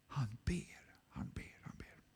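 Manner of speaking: whisper, fading to nothing. Acted. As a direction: let each repeat fade into a whisper. Fading repetition of prayer.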